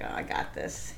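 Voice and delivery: breathy private whisper to herself; whispered confidence. Retold or imitated. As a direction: tell yourself quietly you've got it. Confident thought before nineteen miles.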